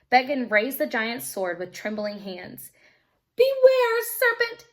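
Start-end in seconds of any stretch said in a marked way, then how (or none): 3.3-4.7 very high, loud battle cry; shout. Acted. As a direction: challenge the dragon. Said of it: "he shouted".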